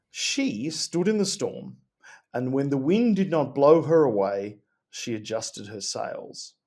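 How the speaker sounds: solemn quote reading. Acted.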